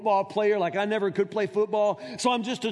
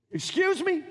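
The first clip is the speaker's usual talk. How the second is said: incredulous excuse me, pitched high; sharp disbelieving retort; indignant; 8 semitones higher than usual. Real, not acted. Preacher interrupts his own quote in indignant disbelief. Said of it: "indignant rhetorical retort".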